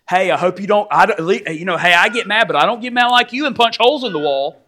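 hammed-up jab about the holes in the wall; wife throwing it back. Wife throws past anger back.

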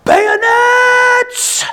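huge high battlefield shout; screaming "Bayonets!" to his men; yelled command. Retold or imitated. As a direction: bellow the order at the top of your lungs. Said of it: "he yells out".

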